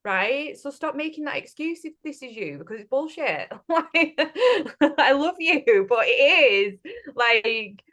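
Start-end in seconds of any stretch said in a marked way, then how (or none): 3.7-4.9 high, loud real burst of laughter. Real, not acted. Laughs at own bluntness. 5.0-7.8 higher and louder, still laughing while scolding lovingly. Real, not acted. Laughing affectionate telling-off.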